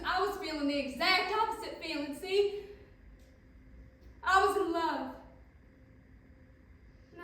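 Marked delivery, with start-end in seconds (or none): 0.1-5.1 high, loud and swept up; carried away, louder and higher; elated confession. Acted. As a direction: let the love burst out, voice raised. Acted confession of being in love.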